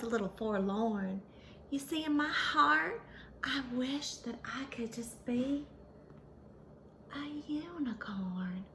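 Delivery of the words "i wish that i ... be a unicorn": dreamy whisper. A narrator's reading. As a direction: whisper the wish like a secret.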